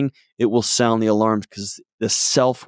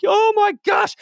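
wife's dismay. Retold or imitated. Wife realizing she has all the keys, hammed-up high exclamation. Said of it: "And she's like".

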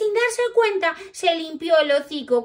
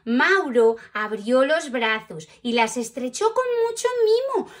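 plain reading voice

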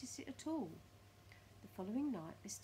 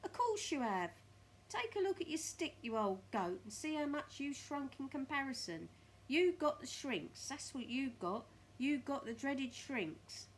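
loud, raised, emphatic bullying; Mr Twit browbeating; 6 semitones higher, 6 dB louder than usual. Acted. Mr Twit convincing his wife of the shrinks, loud bullying. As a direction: hammer it home with loud relish.

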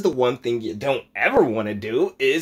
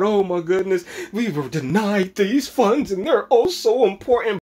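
mock victim; 10 semitones higher, 3 dB louder than usual. Vlogger mocking a congressman playing victim, high and whiny. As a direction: whine like someone playing the victim. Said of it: "playing victim".

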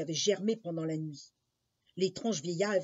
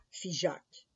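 usual speaking voice

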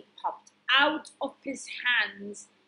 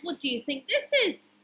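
plain reading voice

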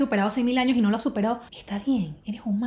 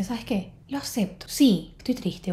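soft, quiet acceptance; 3 dB quieter, slower than usual. Shown as a demonstration. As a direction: drop to a near whisper, gently admitting it. Acting out accepting the emotion. (aceptación suave, casi en susurro)

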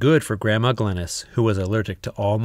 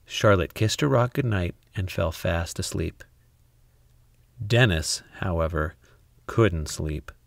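ordinary delivery

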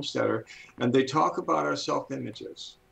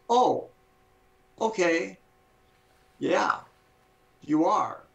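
higher-pitched, naive acceptance; credulous brain; 5 semitones higher, slower than usual. Acted. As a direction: play a brain agreeing with pauses between words. Brain credulously agreeing, voiced as character.

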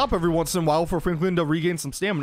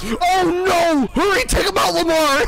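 urgent yell; 8 semitones higher, 5 dB louder than usual. Real, not acted. Urgent callout during a shootout.

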